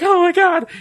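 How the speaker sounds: put-on high frightened girl voice; scared little girl. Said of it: "And she's like".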